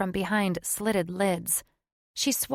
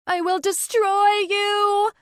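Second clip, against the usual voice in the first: a shrieking, much higher, much louder wail; wailing threat; 7 dB louder than usual. Acted. Witch vowing revenge on Horatu as she leaves, shrieking wail. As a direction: screech it as she vanishes. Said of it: "she wailed".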